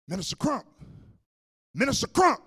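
loud, high urgent call of a pastor. Retold or imitated. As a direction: call the minister out sharply over the commotion. Pastor calling out to him.

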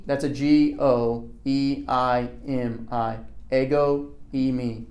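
slow, letter by letter: "E I M I"; spelling out Greek. Shown as a demonstration. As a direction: spell "ego e me" slowly, each letter distinct. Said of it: "It's this right here".

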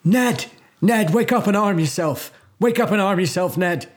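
high, urgent yell through the door. Acted. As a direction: shout to wake someone in the next room. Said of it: "I yell through the door".

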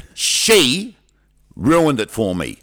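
loud, stretched-out stressed words, high on the first; mock outrage, each phrase dragged out and set apart; aggrieved accusation. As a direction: act out a jilted kid's melodrama. Kid acted as jilted, blaming the girl.

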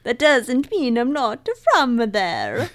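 put-on slow, playful Italian accent; mock Italian accent. Co-host joking she could be from Italy, mock Italian accent. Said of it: "do all of these different voices and accents".

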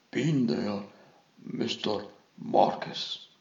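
plain reading voice

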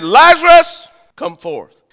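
high ringing call of the name, then dropping; loud voiced command of Jesus. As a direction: call Lazarus out of the tomb. Preacher voicing Jesus' command.

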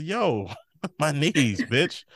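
slow, laughing, lightly voiced complaint about sore knees; imitating Tess laughing. Host retelling Tess griping about her knees, slow and laughing. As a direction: giggle through a mock moan about bad knees. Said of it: "she said".

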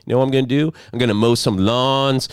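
high, loud mocking party voice; mocking friends. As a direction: ham up an eager friend claiming the holiday. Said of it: "I don't go over on Cinco de Mayo and be like".

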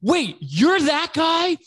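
very high-pitched, gushing excitement; imitating a fan who's lost his cool; starstruck fan. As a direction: go from casual to fawning disbelief. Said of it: "his entire demeanor changes".

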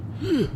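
quick high gasp; startled gasp. Acted. Hoffman spots someone by his trash, startled gasp. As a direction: gasp in sudden surprise. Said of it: "He startles when he sees someone".

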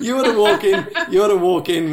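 laughter running through the words; amused laughing. Real, not acted. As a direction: tease along through real laughter. Joking along, still laughing.

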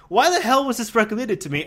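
mock-indignant outburst; incredulous complaint. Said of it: "so I was like".

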